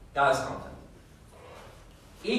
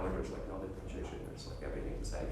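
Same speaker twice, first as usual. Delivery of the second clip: much lower and softer, droning with no variation; monotone demo; 6 semitones lower, 12 dB quieter, faster than usual. Shown as a demonstration. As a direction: show a dull unmodulated drone. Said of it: "so you're not talking like this all the time".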